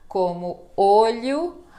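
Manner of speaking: olho slow and loud with a closed O. Shown as a demonstration. Teacher modelling closed O in olho for the class. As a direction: hold the closed O in olho slowly. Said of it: "os sons fechados".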